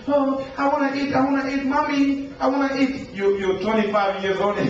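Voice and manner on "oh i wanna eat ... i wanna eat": put-on high, whining little-kid voice begging for food; whiny grown child. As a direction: beg like a spoiled toddler. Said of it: "Imagine a grown person coming".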